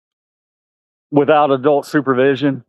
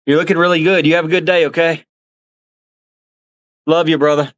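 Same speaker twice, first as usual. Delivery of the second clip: pitched-up buddy talk to a zoo animal; affectionate pet talk; 3 semitones higher than usual. Real, not acted. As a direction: coo to him like a pet you love.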